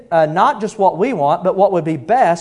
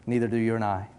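normal talking voice

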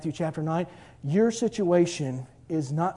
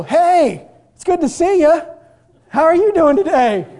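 much higher, louder, cheery greeting; blind guys greeting; 11 semitones higher, 11 dB louder than usual. Acted. Blind men greeting the healed man in the market, high and loud. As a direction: hail a friend across the market. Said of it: "Blind guys go".

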